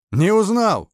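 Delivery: high, loud, mock-amused question; amused taunt. A narrator's reading. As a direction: toss it out teasingly, pitched well up. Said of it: "собеседник рассмеялся". (насмешливо, высоким голосом)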